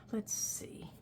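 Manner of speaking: whispering. Real, not acted. Muttering while choosing a page.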